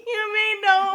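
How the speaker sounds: high squealing laugh, near tears; laughing hard. Real, not acted. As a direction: break up laughing, can't stop. Bursting out laughing at partner.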